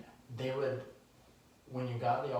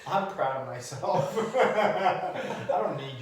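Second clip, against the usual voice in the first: laughing; 7 semitones higher, 8 dB louder, slower than usual. Real, not acted. Laughing at teasing.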